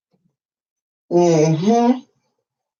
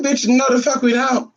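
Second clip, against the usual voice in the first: higher, firmer retort with stress on no; retold sassy refusal; 7 semitones higher than usual. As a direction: lean on no. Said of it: "I said".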